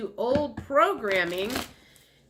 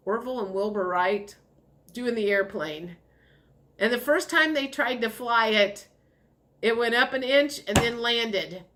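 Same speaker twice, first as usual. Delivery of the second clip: normal talking voice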